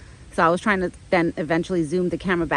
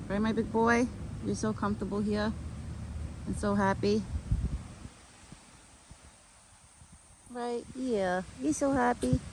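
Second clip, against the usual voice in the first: higher, softer coo at the deer; 3 semitones higher, 8 dB quieter than usual. Real, not acted. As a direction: coo to him like a pet. Talking sweetly to the deer.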